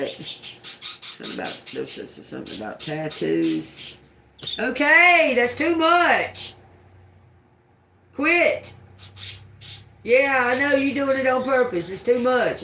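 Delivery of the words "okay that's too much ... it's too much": much higher and louder, begging it to stop; shrill-free high loud complaint; overwhelmed protest. Real, not acted. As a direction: cry out that it's too much. Overwhelmed by the noise.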